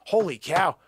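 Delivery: ordinary talk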